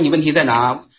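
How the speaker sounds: usual speaking voice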